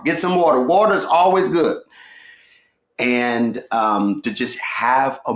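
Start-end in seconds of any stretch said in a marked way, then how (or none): 0.0-1.8 playful emphasis. Real, not acted. Repeats it pitched up.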